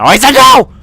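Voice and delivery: yelled no. Real, not acted. Streamer yells at the player chasing him, loud. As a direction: shout it down, fed up. Yelling after repeated no's.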